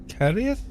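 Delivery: Carieth rising high, clumsy karee-a; mock puzzled English try. Shown as a demonstration. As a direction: stumble over Carieth like an English visitor. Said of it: "God knows how you'd handle that".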